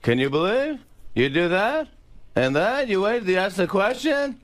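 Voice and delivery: high, rising incredulous questions; Jerry Seinfeld. Shown as a demonstration. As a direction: climb in pitch on each question. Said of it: "There's three different Jerrys".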